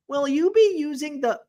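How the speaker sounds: a first, high-pitched try at a robotic drive-thru voice; a hint of a kiosk voice; automated voice. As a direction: start the machine voice, then break off. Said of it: "It's an automated voice".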